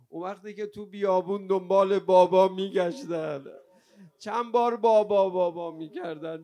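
loud, high, sustained cry of mourning; wailing lament. Real, not acted. Preacher recounting children calling for father, wailing lament. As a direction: wail each phrase at full force.

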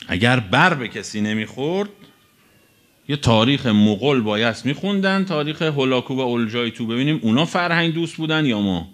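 usual speaking voice

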